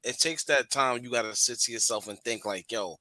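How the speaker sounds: ordinary talk, nothing marked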